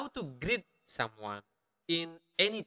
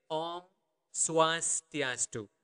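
Om Swastiastu slow, in separated syllables; slow teaching version; much slower than usual. Shown as a demonstration. Teacher showing learners how to say hello, slowly and clearly. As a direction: stretch out Om Swastiastu with a pause after Om. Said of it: "That would be the normal speed".

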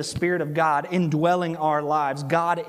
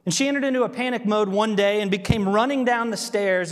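ordinary talk

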